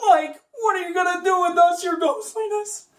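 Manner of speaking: high frightened stoner; scared Shaggy. Acted. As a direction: beg the ghost nervously.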